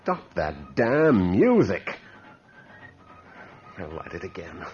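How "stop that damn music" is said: much louder, slow, drawn-out yell; furious shout. Acted. Man screaming at the ghostly march music to stop. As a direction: bellow it at the unseen tormentor.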